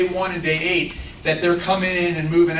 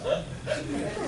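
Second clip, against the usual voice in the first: laughing; 6 semitones higher, much slower than usual. Real, not acted. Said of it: "I'm going to cry".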